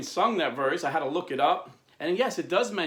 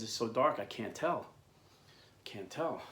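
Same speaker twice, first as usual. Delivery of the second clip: softer, lower, helpless answer; subdued reply; 4 semitones lower, 6 dB quieter than usual. Retold or imitated. Francis answering prisoners in the dark, subdued. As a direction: let it sink quieter on the repeat. Key cannot see the flag.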